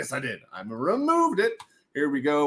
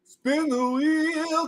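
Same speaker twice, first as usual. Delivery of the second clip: drawn out; 8 semitones higher, much slower than usual. Pretend. Drawn-out announcement.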